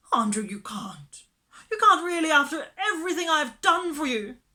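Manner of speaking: high, loud tearful plea; nearly weeping. Acted. Miss Lark pleading with Andrew not to leave, nearly weeping. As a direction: beg the dog, on the edge of tears. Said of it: "Miss Lark was nearly weeping".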